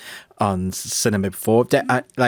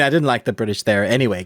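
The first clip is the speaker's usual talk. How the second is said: usual speaking voice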